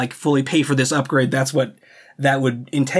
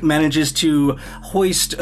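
ordinary talk, nothing marked

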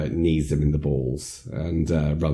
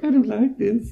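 scared. Retold or imitated. Sarah scared in swerving car.

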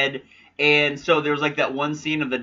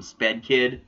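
normal talking voice

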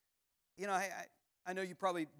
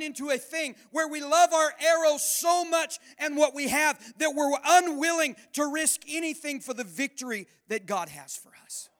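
loud, high, impassioned preacher's build; preaching at full force; 8 semitones higher, 9 dB louder than usual. Real, not acted. Pastor to congregation, loud impassioned preaching. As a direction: drive it home like a revival preacher.

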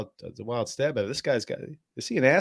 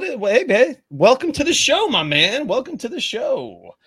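higher, louder hyped greeting; big welcome; 9 semitones higher, 7 dB louder than usual. Acted. Host hyping up the new guest, loud and excited.

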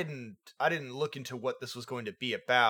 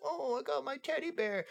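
put-on higher, softer, pouty little-girl voice; mock her like a sulky small child; pouty childlike voice; 11 semitones higher, 8 dB quieter than usual. Mocking a teenage character at the hospital with a pouty childlike voice. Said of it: "a pouty, childlike persona".

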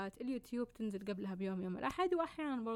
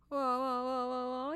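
blah blah filler; 4 semitones higher, 5 dB louder, slower than usual. Shown as a demonstration. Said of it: "يعني كذا كلام طويل عريض".

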